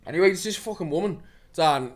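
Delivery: ordinary delivery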